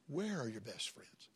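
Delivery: sudden near-hush, drawn slightly out; hushed question. Acted. Preacher asks his congregation in a hushed voice. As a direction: pull them in with a low hushed ask.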